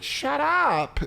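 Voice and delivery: very high, squealed comeback; bullied kid. Retold or imitated. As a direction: imitate an upset child gamer snapping back. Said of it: "when they're kids".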